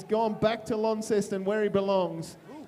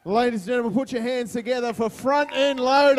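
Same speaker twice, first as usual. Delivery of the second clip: booming MC band intro; grand entrance announcement; 4 semitones higher, 7 dB louder than usual. Real, not acted.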